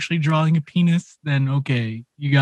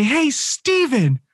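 pointed call-out; 9 semitones higher, much slower than usual. Retold or imitated. Calling coworker in story.

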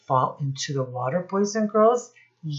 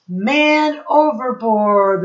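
slow, loud, higher call of man overboard; sailors crying out; 6 semitones higher, 7 dB louder, slower than usual. A narrator's reading. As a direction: shout the alarm across the deck. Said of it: "the sailors cried".

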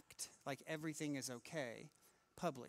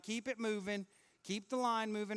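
raised, louder crowd-mover call; acted amusement park attendant moving people on; [name] as line attendant; 5 semitones higher, 5 dB louder than usual. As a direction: herd a queue along briskly. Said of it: "like he's at the amusement park".

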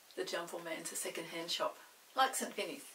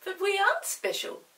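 high, louder protest; puzzled Peter; 13 semitones higher, 9 dB louder than usual. Acted. Peter telling the goblin they are not special, high and puzzled. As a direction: object plainly.

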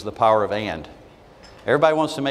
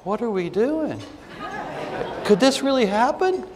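high, worried newcomer voice; new person; 13 semitones higher, slower than usual. Retold or imitated. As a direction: mimic a nervous newcomer asking. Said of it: "the new person goes".

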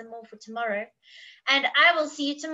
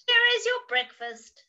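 zookeeper; 8 semitones higher than usual. Acted. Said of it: "said the zookeeper".

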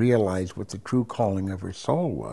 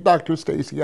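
higher, louder urgent blurt; 6 semitones higher, 5 dB louder than usual. Retold or imitated. Patient suddenly confessing to the doctor, blurted out. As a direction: burst out with it to the doctor. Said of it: "I blurted it out right then and there".

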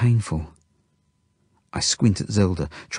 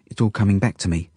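ordinary delivery, nothing marked

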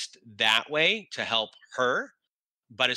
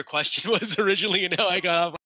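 laughing; 7 semitones higher, 5 dB louder, much faster than usual. Real, not acted. Laughs at own tangent.

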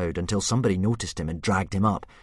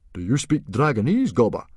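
loud, raised question in surprise; surprised; 5 semitones higher, 7 dB louder than usual. Acted. Stoic questions volunteer Gobber about Dragonese, surprised. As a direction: ask it taken aback, loudly. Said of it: "asked Stoic in surprise".

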